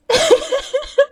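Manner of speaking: high burst of laughter. Real, not acted. Laughing at herself.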